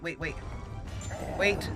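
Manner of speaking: usual speaking voice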